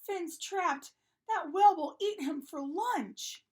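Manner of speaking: high, loud alarm; crying out. A narrator's reading. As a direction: cry out the danger in a panic. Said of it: "Rita cried".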